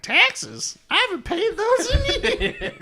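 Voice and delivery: put-on high, old grandma voice, laughing. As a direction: play the cackling old grandma. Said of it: "and she was like".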